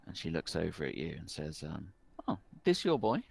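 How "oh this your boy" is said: raised, brighter query; a curious barmaid butting in; brisk innkeeper. Acted. Owner leans across bar and asks about the boy.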